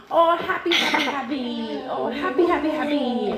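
high, singsong demonstration of delight; exaggerated happy. Shown as a demonstration. Mother acting out happy for her child, gleeful. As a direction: chant it in gleeful play, pitch up. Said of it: "What about happy?".

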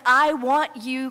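usual speaking voice

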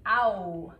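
"ow" as in cow, drawn out; misread vowel. Shown as a demonstration. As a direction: say "ow" loudly, as the spelling misleads. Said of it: "it looks like it would be".

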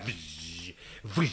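soft whooshing wind imitation; howling wind noise. Storyteller imitates the wind over the polar night, soft whoosh. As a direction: blow a long, hushed "vzhh". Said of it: "Căci vântul bătea".

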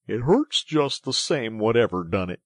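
pained complaint. A narrator's reading. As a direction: insist crossly that you're hurting. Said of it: "forgetting his grammar in his pain".

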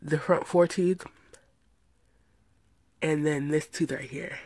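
speaking with finger in mouth; finger pulling lip down. Real, not acted. Seen 4: finger pulls lower lip down, showing braces, throughout the words.

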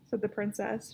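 ordinary delivery, nothing marked

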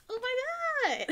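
high, loud laughing exclamation; delighted laughing. Real, not acted. Player remembers her first gameplays, delighted laughter. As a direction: burst out laughing as you recognise it.

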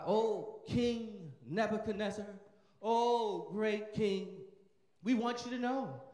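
slow, drawn-out royal address; bow and flatter the great king; courtly satrap. Acted. Satrap flattering the king, slow and grand.

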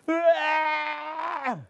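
put-on high, loud child's wail; mock wail. Gundul answering the kind mother, high mock wail. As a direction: burst out bawling. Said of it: "dijawab begitu".

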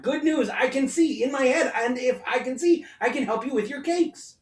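bubbling with good news; eager boy. A narrator's reading. Herschel telling his mother he can help, eager. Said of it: "he called out".

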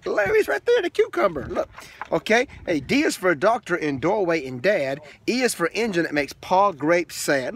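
lilting read-aloud rhythm; sing-song picture book reading; reading to children. Acted. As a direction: read the rhyming book to a toddler.

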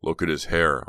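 usual speaking voice

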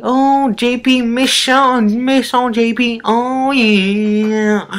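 high, goofy singsong put-on voice; hamming it up in a fake voice for viewers; silly put-on voice. Put-on voice held at start.

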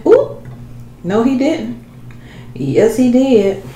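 high, delighted exclamation; excited reaction. Real, not acted. Reacting to the taste.

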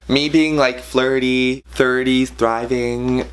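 normal talking voice

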